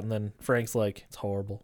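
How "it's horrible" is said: heavy disgust on "horrible", dragged low and sour; stank on horrible. Retold or imitated. Uncle retelling a line, heavy stank on "horrible". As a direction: lean hard into "horrible" with a sour, low groan. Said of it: "he really puts some stank on that first horrible".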